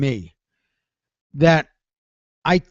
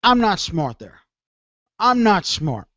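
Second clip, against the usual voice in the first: normal talking voice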